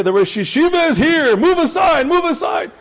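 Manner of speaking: high urgent call to make room; calling out to clear the way. Pretend. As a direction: shout for the crowd to move aside. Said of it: "run and say".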